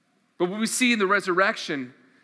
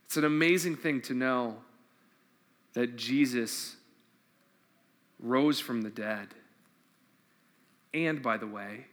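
tender closing; 8 semitones lower, 7 dB quieter than usual. Real, not acted. Closing reflection on the resurrection.

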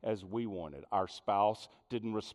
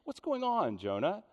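gentle caring question; 12 semitones higher, faster than usual. Acted. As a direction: draw him out kindly. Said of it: "He, he's rather".

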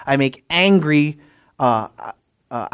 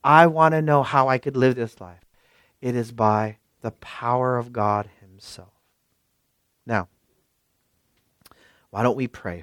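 ordinary talk

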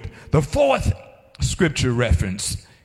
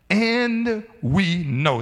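"And we know" slow and raised in pitch; emphatic; 5 semitones higher, much slower than usual. Acted. Preacher repeating Paul's opening to his listeners, emphatic. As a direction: hold each word of "And we know" with firm certainty. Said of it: "this is emphatic".

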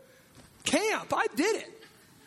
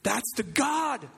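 preaching shout; much slower than usual. Real, not acted. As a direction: drag it out and shout the praise. Preacher's climax.

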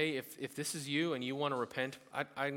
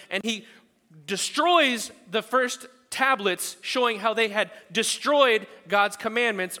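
loud, high, slowing preacher; fervent preaching; 9 semitones higher, 13 dB louder than usual. Acted. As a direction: hammer the story home. Preaching at full force.

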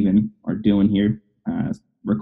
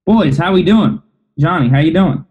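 hyped host; 6 semitones higher than usual. Real, not acted. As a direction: pump the listeners up. Hyped restart of the welcome.

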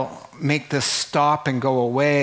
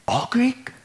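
higher, incredulous rise on Greek; a playful gasp of disbelief; mock surprise; 9 semitones higher than usual. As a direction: act stunned that they are all Greek. Incredulous echo of the list.

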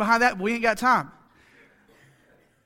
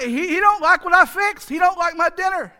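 higher, louder mock wife, hurt; hammed-up hurt wife, pitched up; imitating his wife; 7 semitones higher, 5 dB louder than usual. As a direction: play the wife sure he hates her cooking. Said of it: "And she's like".

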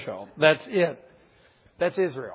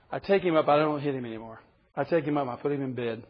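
ordinary talk

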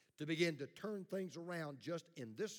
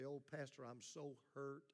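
a soft, hammed-up wounded churchgoer, faster at the end; mock hurt congregant; 3 semitones lower, 9 dB quieter than usual. Pastor mimics a wounded listener, soft mock hurt. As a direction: play the hurt listener, lightly mocking. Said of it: "if your heart's tender ... and you say".

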